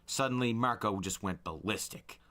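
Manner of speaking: plain reading voice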